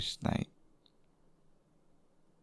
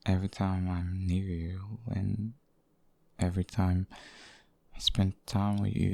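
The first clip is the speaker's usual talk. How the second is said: ordinary talk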